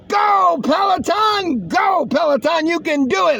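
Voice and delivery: high shouted cheer, slow on Peloton; hammed-up roadside fan cheering; mock cheer.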